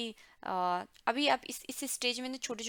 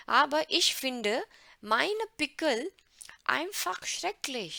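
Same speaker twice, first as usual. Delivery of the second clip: louder, slow, dramatic German read; higher, louder, slow mock lament; mock despair; 5 semitones higher, 5 dB louder, much slower than usual. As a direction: ham up the teenage despair. Dramatic reading of pimple complaint.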